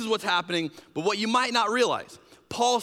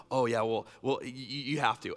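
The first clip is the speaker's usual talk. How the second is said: sheepish insider; 6 semitones lower, 5 dB quieter than usual. Retold or imitated. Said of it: "coming and going".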